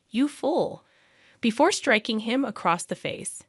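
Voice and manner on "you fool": understated narrated yell of outrage. A narrator's reading. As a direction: hurl the insult in a restrained, higher voice. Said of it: "grabbed him by the collar and yelled".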